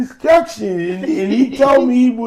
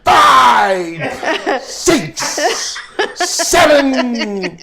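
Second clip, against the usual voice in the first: louder, higher mock boxing count in your face; Stonewall counting loud; 4 semitones higher, 4 dB louder, much slower than usual. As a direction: bark the count right in his face. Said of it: "That's how he talks".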